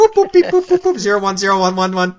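high mock computer beeping; computer beeps. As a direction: beep it out like a machine. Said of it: "let me send him a virus".

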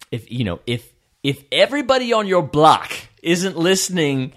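loud, high put-on voice.

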